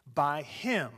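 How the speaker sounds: normal talking voice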